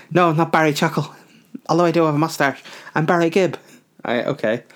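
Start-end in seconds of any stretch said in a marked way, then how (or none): 0.1-3.6 [name] contestant voice. Retold or imitated. Contestant answers Simon in voice.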